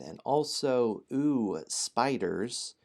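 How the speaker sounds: usual speaking voice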